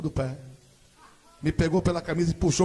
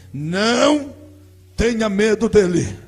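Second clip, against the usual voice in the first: slow, loud, solemn divine voice; a booming voice from heaven, slow; voice of God; 9 dB louder, much slower than usual. Retold or imitated. Preacher voices God to the congregation, loud and slow.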